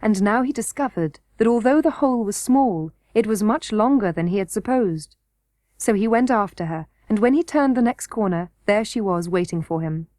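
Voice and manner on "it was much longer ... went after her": ordinary delivery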